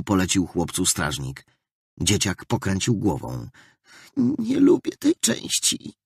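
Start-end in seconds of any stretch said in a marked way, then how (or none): 4.2-5.9 put-on high, slow whining little boy; reluctant child. A narrator's reading. The boy balks at the guard, high and whiny. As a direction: plead like a small boy dreading it. Scared boy before second cut.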